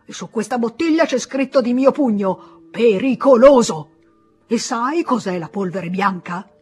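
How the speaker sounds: loud, high, outraged scolding; angry lecture. Acted. Pharmacist lectures the terrified boy, loud outraged scolding. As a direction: hammer every danger home.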